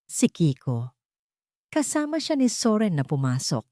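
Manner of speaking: ordinary delivery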